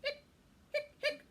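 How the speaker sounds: high squeaky hiccups; hiccup sounds. Acted. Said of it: "Ghost told skeleton".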